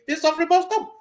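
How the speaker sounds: pitched high, a mild mock outcry; rising cry that suffering must stop. Caller voices a protester demanding change. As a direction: call out like the next protester. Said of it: "rise again and tell you".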